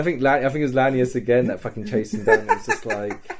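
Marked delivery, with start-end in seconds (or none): none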